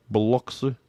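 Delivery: Mississippi buh-LUK-suh, jaw hanging loose; slack-jawed Mississippi accent. Retold or imitated. Imitating how Mississippi locals name the town, slack-jawed Mississippi accent. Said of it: "they got that slack-jawed accent".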